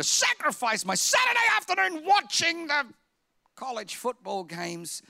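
high, loud indignant protest; exaggerated outburst. Pastor mocking his own football excuse, loud and high. Mock pleading about football.

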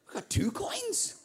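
high, slow, laughing disbelief; mock amazement. Newlyweds amazed at having two coins, high laughing disbelief. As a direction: marvel in mock surprise.

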